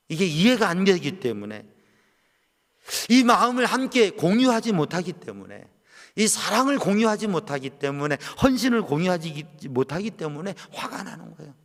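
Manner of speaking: preacher building to a climax; rising preaching. Acted. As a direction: drive the point home, then ease down.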